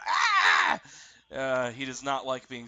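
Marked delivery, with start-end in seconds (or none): 0.0-0.8 high, loud pained shriek. Acted. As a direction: shriek as if stabbed. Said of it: "lets out a".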